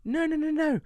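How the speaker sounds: rapid string of high-pitched noes; high-pitched dismissal. Real, not acted. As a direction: bat the wrong guess away up high. Waves away a wrong guess.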